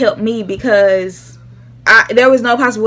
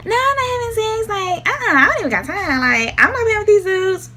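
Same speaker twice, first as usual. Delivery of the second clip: high, breezy denial; pitched-up casual lie; lying teen; 10 semitones higher than usual. Retold or imitated. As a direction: act innocent like a teen. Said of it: "straight lied to him and said".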